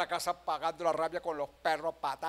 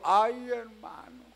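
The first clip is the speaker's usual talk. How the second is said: queja imitada; 5 semitones higher, much slower than usual. Retold or imitated. Mimics a complaining brother.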